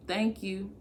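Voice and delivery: ordinary talk, nothing marked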